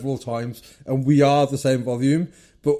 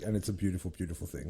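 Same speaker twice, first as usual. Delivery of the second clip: softly savoured, much softer and lower; tender awe; 8 semitones lower, 14 dB quieter, faster than usual. Real, not acted. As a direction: say it as if it's the sweetest news. Savouring his team's promotion.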